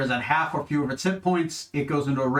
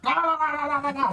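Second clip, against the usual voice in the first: battle cry; 11 semitones higher than usual. Retold or imitated. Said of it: "everyone raises weapons and you start".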